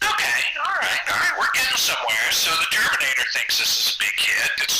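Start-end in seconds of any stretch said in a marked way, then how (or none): 0.1-2.3 thrilled. Retold or imitated. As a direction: burst out with delight. Thrilled at Arnold's endorsement.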